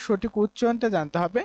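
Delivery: ordinary talk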